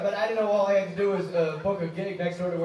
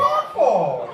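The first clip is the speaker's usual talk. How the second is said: normal talking voice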